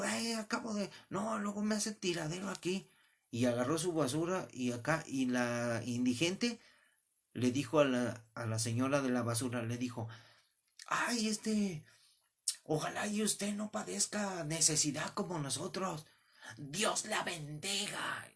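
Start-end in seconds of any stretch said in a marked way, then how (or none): 0.0-2.9 complaining neighbour woman. Retold or imitated. Woman complains about the homeless. 10.9-18.3 higher, slow, sugary put-on blessing; mock pious beggar woman. Homeless woman blesses the neighbour, high and syrupy.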